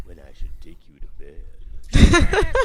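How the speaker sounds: low, slow, loud come-on; sleazy low seduction. Acted. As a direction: purr it like a cheesy lover. Sleazy flirt line, low slow.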